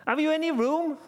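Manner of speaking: high shouted plea; loud demand. Acted. Joseph asking the innkeeper for room, shouting. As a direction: call it out high and urgent.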